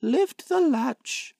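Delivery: put-on high, slow old granny; frail old woman calling out; grandmother calling. A narrator's reading. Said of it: "called out the grandmother".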